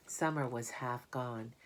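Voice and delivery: ordinary delivery, nothing marked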